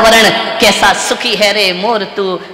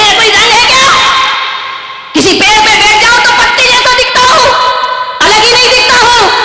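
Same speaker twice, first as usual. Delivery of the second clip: parrot complaining; 11 semitones higher, faster than usual. Acted.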